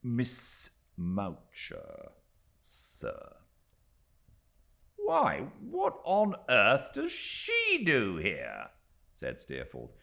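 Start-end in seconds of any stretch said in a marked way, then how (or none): 0.0-3.4 unhurried, superior manservant; slow condescending valet. A narrator's reading. Littimer repeats the name. 5.0-8.7 high, slow, incredulous; astonished question. A narrator's reading. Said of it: "much astonished".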